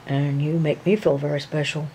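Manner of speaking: usual speaking voice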